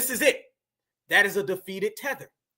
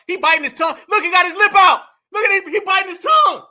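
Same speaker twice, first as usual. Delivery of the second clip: higher, louder, gleeful yelling; excited shout; 7 semitones higher, 8 dB louder than usual. Real, not acted. Host yelling at a video guest, gleeful shout. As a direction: point and holler at the screen.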